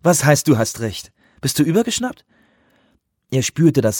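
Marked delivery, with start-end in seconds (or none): 0.0-2.2 empörte Frau. A narrator's reading. Delaware fährt ihn empört an.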